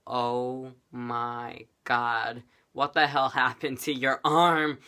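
very slow, drawn-out disbelief; stunned nurse. Retold or imitated. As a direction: imitate a nurse aghast, word by word. Voices stunned nurse seeing arm.

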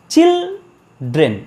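চিলড্রেন in two slow, separated beats; high, drawn-out চিল, pause, then ড্রেন dropping; syllable by syllable. Shown as a demonstration. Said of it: "এবার উচ্চারণটা করো। দেখো".

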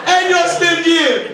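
high, loud yell after a car; guy chasing him down yelling about gas; chasing shout. Retold or imitated. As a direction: call out to a driver pulling away. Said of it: "this guy was chasing me down going".

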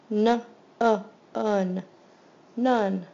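loud and segmented; sounding out none. Shown as a demonstration. Teacher sounding out a word for students, loud and segmented. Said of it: "This is the oddball".

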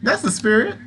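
high, bright burst of praise; excited cheer. Acted. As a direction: cheer them on with a jump up. Spider cheers player on.

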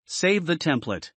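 ordinary talk, nothing marked

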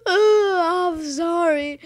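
hammed-up tearful gasp, high and drawn out; mock emotional. Gamer apologising after his pep talk, mock tearful. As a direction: fake choking up as you apologise. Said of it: "I get so emotional".

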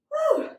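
high, loud woo; whoop. Real, not acted. Instructor recovering after a cardio set, whooping. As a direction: let out a high whoop of exertion.

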